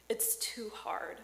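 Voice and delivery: slow, hushed whisper; whispered despair. Retold or imitated. Pastor quoting a weary woman from Barbie, whispered. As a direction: let it drop to a weary whisper. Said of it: "I'm gonna quote part of this monologue".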